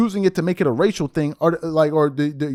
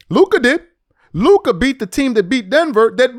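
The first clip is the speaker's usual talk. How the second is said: higher, louder punch on Luka; emphatic; 5 semitones higher, 4 dB louder than usual. Real, not acted. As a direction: hammer the point home, louder. Repeats the point for emphasis.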